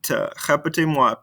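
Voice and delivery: ordinary talk, nothing marked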